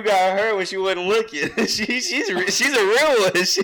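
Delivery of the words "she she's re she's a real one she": high, loud, laughing praise. Real, not acted. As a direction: crack up while praising. Laughing about the girl.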